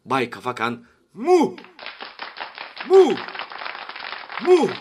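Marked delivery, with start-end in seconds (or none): 1.1-4.7 high, loud syllable popping out repeatedly; hiccup-like. A narrator's reading. Prime minister stuck on his first syllable, hiccup-like. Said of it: "hıçkırık tutmuş gibi".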